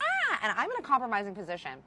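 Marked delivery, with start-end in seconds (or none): 0.0-0.4 a sudden high mock shriek of panic; startled high yelp; retold shriek. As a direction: squeal like a bug just landed on you. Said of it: "I freak out".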